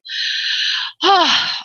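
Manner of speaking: long breathy exhale; heavy sigh. Real, not acted. Medium overcome by mother's love, heavy sigh. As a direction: let out a big, emotional sigh.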